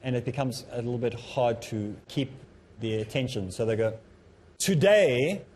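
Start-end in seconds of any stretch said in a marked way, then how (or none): 4.6-5.4 "Today" drawn out and hit louder and higher; stress on first word. Shown as a demonstration. Speaker showing predictable emphasis on the first word, heavy opening stress. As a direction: open on a heavily emphasized "Today". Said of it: "Some put the emphasis on the first word".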